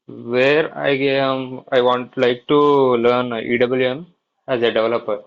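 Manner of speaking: ordinary talk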